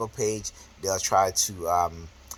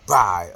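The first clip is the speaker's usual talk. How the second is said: "bye" punched out louder; emphatic bye; 7 dB louder than usual. Retold or imitated. Imitating her ending a phone call, "bye" louder and emphatic. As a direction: hit "bye" hard, imitating her sign-off. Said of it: "she say it with the emphasis".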